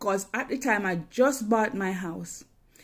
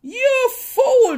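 much higher and louder, scolding yell; cursing; 12 semitones higher, 11 dB louder, much slower than usual. Retold or imitated. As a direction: point and curse in her face. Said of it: "She cursed me".

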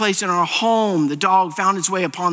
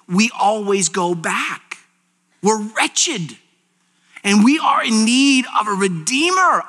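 normal talking voice

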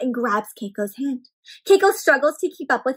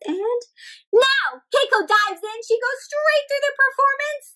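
excited narration; 10 semitones higher than usual. A narrator's reading. Dramatic climax narration.

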